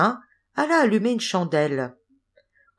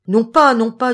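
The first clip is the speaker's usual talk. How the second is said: louder, higher objection; insistent protest; 5 semitones higher, 8 dB louder than usual. A narrator's reading. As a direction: object firmly and loudly. Tiny man protests to his carrier.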